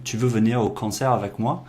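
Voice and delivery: Tu veux venir au concert avec moi as a statement rising on "moi"; upward inflection. Shown as a demonstration. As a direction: lift "avec moi" at the end to make it a question. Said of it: "adding the upward inflection at the end".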